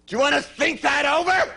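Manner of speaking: the newborn speaks up, high and cheeky; talking baby. Retold or imitated. Comic voicing a giant newborn to the doctor, high voice. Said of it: "The kid said".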